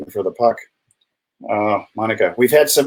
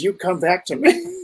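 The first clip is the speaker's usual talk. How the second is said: quoting the movie line; 7 semitones higher than usual. Retold or imitated. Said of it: "her line was".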